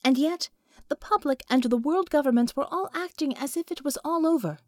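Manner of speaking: ordinary delivery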